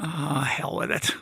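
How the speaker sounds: breathy whisper of giving in, slow; whispered thought. Retold or imitated. Passenger talking himself into it, slow whisper. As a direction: mutter it under your breath, deciding. Inner thought before speaking.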